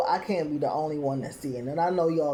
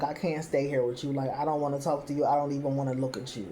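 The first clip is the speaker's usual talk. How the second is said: quick quiet rant; 3 semitones lower, 5 dB quieter, faster than usual. Retold or imitated.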